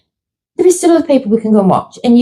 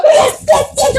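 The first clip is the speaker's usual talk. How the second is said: much higher, shouted, laughing yeses; screeching yes; slower than usual. Retold or imitated. Host relives the ticket confirmation, screeching with joy. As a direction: scream yes like you just won. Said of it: "I was like".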